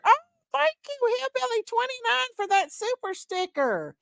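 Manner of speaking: high, delighted thank-you; excited thanks. Real, not acted. Host thrilled by a super sticker, high excited thanks. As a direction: lift it up high with joy.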